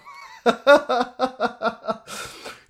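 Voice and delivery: high burst of laughter; loud laugh. Real, not acted. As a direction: laugh out loud at your own joke. Laughing at own rant.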